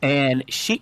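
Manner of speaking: usual speaking voice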